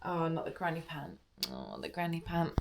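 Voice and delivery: normal talking voice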